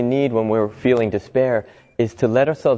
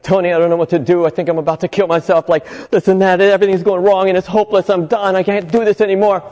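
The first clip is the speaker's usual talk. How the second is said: raised, pleading voice of a desperate caller; 6 semitones higher than usual. Retold or imitated. Suicidal caller begging Tony Robbins for help, raised and pleading. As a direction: plead like someone at the end of their rope.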